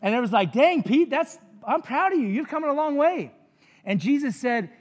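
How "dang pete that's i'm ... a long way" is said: high, louder mock-impressed congratulations; impressed praise. Retelling praise for Pete on his answer, hammed-up and impressed. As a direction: play up being proud of a pupil.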